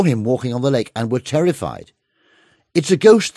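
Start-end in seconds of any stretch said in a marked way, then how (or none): 2.7-3.3 high, loud cry of fear; frightened cry. A narrator's reading. Terrified disciples crying out at a figure on the lake, frightened shout. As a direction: shriek it like you've seen a ghost. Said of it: "they cried out in fear".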